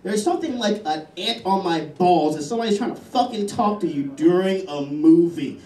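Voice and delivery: ordinary talk, nothing marked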